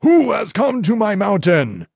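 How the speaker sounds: high, loud angry god's cry; thundering, outraged challenge, pitched high; angry shout. A narrator's reading. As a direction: shout it in anger at an intruder. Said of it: "an angry voice shouted".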